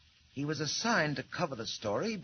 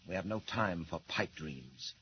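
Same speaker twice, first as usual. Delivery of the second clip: ordinary delivery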